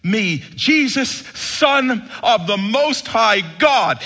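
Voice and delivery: loud, high, slow, drawn-out cry; demon's shout. Acted. Demon crying out to Jesus from the shore, slow loud shout. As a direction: bellow it like a cornered demon. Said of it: "he shouted at the top of his voice".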